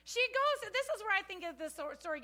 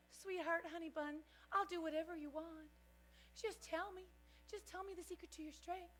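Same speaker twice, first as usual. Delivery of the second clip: sweet pleading; 8 dB quieter than usual. Retold or imitated.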